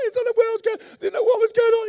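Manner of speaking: put-on high, loud wailing child; hammed-up ugly crying to dad; mock bad cry. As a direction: blubber like a sobbing three-year-old. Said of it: "I'm doing the bad cry".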